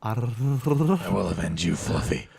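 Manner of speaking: mock avenger vow. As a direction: pretend grim hero. Mock vow for killed cat.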